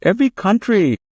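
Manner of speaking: high-pitched mock Chinese accent; put-on Chinese accent. Interviewer ambushing the guest, exaggerated Chinese accent. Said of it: "I was emulating her accent".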